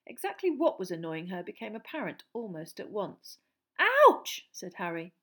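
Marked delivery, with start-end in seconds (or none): 3.8-4.5 high sudden yelp; ouch. A narrator's reading. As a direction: yelp in surprise. Hit by owl.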